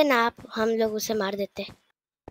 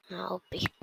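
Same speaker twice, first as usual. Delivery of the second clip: whisper; 4 semitones lower, 10 dB quieter than usual. Real, not acted. Whispering to teammate mid-game.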